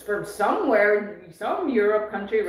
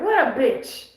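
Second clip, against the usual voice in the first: lightly imitated Serbian accent, a little higher; 4 semitones higher, 4 dB louder than usual. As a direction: repeat her put-down in a mild Serbian accent. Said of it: "she even put that accent on".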